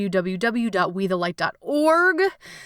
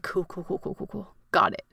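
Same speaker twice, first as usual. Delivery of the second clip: much softer, deflated repeated cool; a quiet, fading string of cool; resigned mutter; 5 dB quieter than usual. Retold or imitated. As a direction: let it fade into defeat. Resigned acceptance, retold.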